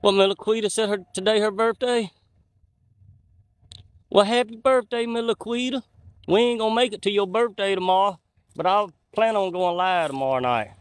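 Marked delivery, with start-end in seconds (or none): none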